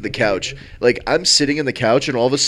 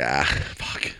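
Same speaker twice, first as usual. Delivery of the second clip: breathy mock groan; 10 semitones lower, 7 dB quieter than usual. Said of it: "And I was like".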